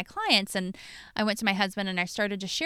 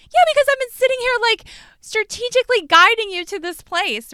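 loud, high and fast; a laughing-edged loud told-you-so; emphatic retort; 13 semitones higher, 10 dB louder than usual. Retold or imitated. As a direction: fire back, voice up high and fast.

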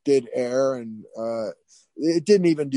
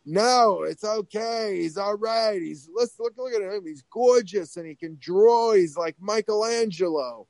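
higher, gushing mock Long Island voice; Harvey Fierstein; 6 semitones higher than usual. School boss reassuring the grandmother about the boy, Fierstein impression. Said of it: "sounded like Harvey Fierstein".

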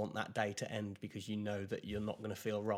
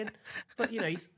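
ordinary talk

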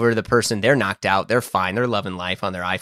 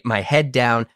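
ordinary delivery

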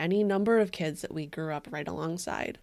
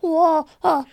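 put-on high, loud child's voice; little kid's voice; 9 semitones higher, 9 dB louder than usual. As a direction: squeal it like a little kid. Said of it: "that's a little kid's voice".

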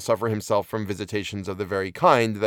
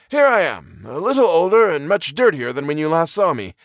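put-on high-pitched, louder old professor voice; lightly amused, high exclamation; laughing old professor; 6 semitones higher, 4 dB louder than usual. A narrator's reading. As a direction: hail a friend in an older man's raised voice. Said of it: "He glanced down laughingly at his travel-stained garments".

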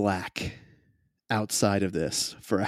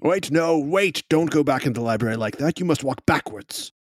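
fussy gentleman; 4 semitones higher than usual. Acted. NPC insisting.

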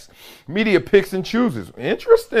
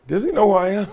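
amused disbelief; 5 semitones higher, faster than usual. Retold or imitated. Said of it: "I was just sitting there like".

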